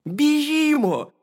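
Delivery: high, loud, drawn-out call; hedgehog's cry. A narrator's reading. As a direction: call out urgently as a little hedgehog. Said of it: "крикнув їжачок".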